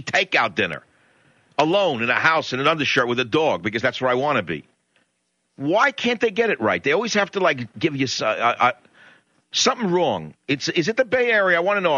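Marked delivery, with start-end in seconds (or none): 5.6-12.0 exasperated rant. Real, not acted. Ranting about service, pitch high.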